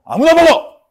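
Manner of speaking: high, loud dismissive shout; careless shout. Acted. Giver handing the last chocolate to any student, careless shout. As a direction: burst out as if flinging food at anyone.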